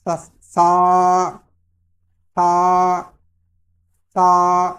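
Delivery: سا drawn out slowly, no whistle; model sa sound. Shown as a demonstration. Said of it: "اس کو میرے ساتھ repeat کریں".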